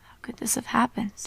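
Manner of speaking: ordinary delivery, nothing marked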